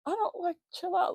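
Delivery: high dismissive mock of him; mocking boyfriend. Mocking boyfriend telling her to chill out, high and dismissive. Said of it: "And he was like".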